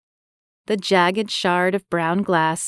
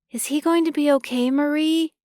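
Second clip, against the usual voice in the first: put-on worried boy; 9 semitones higher than usual. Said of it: "concern evident in his shaky voice".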